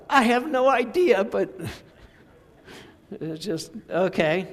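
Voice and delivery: high, loud giggly voice breaking into laughter; laughing son. Retold or imitated. Father imitating his laughing son, high and giggling. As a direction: play the youngest son who can't stop laughing. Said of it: "He's like".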